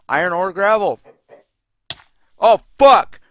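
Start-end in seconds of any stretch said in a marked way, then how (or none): 2.4-3.2 louder, higher startled curse; startled swearing. Real, not acted. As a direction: curse in sudden alarm. Startled by tainted chicken in game.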